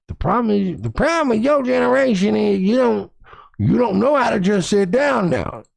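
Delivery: put-on cranky old-timer preaching at the kids. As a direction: lecture the young ones like a sassy old grandma.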